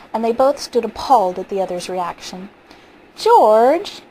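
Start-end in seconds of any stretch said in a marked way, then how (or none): 3.2-4.0 loud, raised cry of his name; alarmed cry. Acted. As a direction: call out to your husband in fright. Wife cries out after escape.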